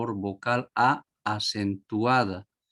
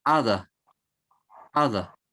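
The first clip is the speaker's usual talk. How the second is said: hada with the first a stressed. Shown as a demonstration. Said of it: "La mayor fuerza de voz en la primera vocal A".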